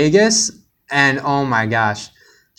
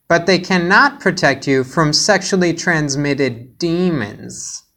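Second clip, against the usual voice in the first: imitating a lecturing schoolteacher, higher; preachy teacher; 5 semitones higher than usual. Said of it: "they were like".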